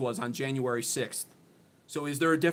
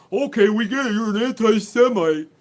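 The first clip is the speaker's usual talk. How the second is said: higher, louder, whiny mock voice; mocking chatter; 6 semitones higher, 8 dB louder than usual. Streamer mimicking a spamming chatter, in a higher, louder mocking voice. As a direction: sneer it back as the spammer would say it. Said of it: "when somebody's just gonna".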